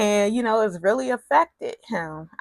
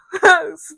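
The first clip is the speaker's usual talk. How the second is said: burst of laughter; 8 semitones higher, 6 dB louder than usual. Real, not acted. Laugh after outburst.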